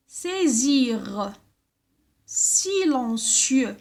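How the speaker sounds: slow, fully pronounced words with pauses between; careful word-list reading. Shown as a demonstration. Teacher reading the exercise words to children, careful pronunciation. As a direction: read each word out deliberately, stretching the vowels. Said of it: "Il faut les bien prononcer".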